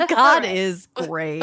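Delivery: slow mock prayer with laugh. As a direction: intone it like grace. Mock grace.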